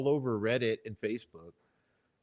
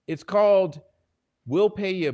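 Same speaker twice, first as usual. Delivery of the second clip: loud, raised-pitch outburst; angry outburst; 7 semitones higher, 10 dB louder than usual. Real, not acted. Streamer explaining paid silence, angry outburst. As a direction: burst out with it, high and loud.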